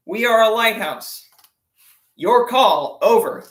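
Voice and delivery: firm radio reply. Retold or imitated. Coast Guard radios the battleship, loud and firm. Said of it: "they radio us back and they say".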